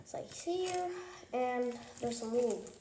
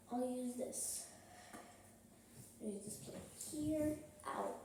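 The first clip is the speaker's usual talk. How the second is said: soft, hushed voice, much softer; talking quieter; 2 semitones higher, 5 dB quieter than usual. Real, not acted. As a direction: murmur so the house stays asleep. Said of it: "my parents are sleeping and I don't wanna wake them up".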